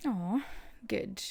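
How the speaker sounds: usual speaking voice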